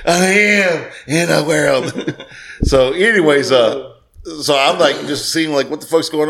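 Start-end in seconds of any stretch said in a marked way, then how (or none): 0.0-2.2 very slow, drawn-out mock movie voice; Full Metal Jacket quote. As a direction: stretch every word of the film impression. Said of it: "Full Metal Jacket".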